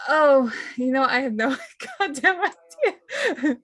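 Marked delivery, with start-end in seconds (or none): 1.8-3.6 laughing through the words, pitched up; slow, higher, breaking into laughter. Acted. Player admits having no idea, laughing. Amused reply to a question.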